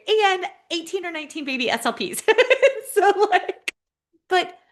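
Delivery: high, loud laughing talk. Real, not acted. Panelist laughing proudly about her students, laughing high and loud. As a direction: laugh through the words, delighted.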